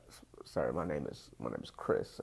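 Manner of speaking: low, timid and stammering; nervous stammer. Retold or imitated. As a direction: stumble over the words like a scared boyfriend. Nervous reply to her dad.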